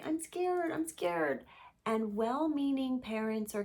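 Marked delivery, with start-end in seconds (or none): 0.0-1.4 high-pitched mock scared child; scared little kiddo. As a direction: imitate a frightened kid, voice pitched way up. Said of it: "little kiddo who's like".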